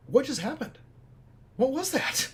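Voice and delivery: high, bewildered cry; baffled at own impulsive call; stunned disbelief. Retold or imitated. Confused son asking himself what just happened. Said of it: "And I was like".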